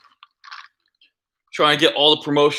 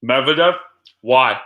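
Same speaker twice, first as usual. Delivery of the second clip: ordinary talk, nothing marked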